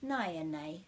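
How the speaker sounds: naya nei said slowly and carefully; slow it right down for copying; slow, clear model. Shown as a demonstration. Teacher slowly modelling naya nei to practise. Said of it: "Just practice saying naya nei".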